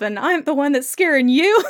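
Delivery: laughing through an indignant retort. Retold or imitated. Retelling Jamie answering Claire, laughing through it. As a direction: crack up mid-quote.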